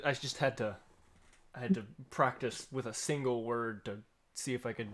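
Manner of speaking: usual speaking voice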